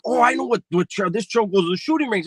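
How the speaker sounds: high, keen voice of the homeboy; eager friend. Retold or imitated. Friend pointing out the trail, eager high voice. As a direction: chime in high and eager like the buddy who knows the trail. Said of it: "he was like".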